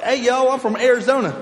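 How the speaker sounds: a hammed-up "Hey, yo" in an Arizona accent; a playful fake Arizona accent. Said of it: "That's an Arizona accent".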